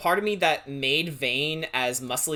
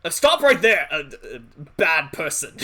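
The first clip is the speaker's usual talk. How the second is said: hammed-up shouting guard; mock guard shout; 7 dB louder than usual. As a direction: bark it like a town guard catching a thief. Playing Oblivion guard line.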